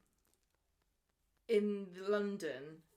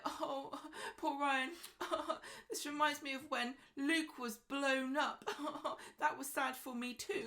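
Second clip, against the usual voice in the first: higher mock pity broken by laughs; mock Alia self-pity; 8 semitones higher than usual. Imagining Alia turning Ryan's attack to herself, high and laughing. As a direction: play it as fake sorrow through giggles. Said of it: "She's probably gonna be like".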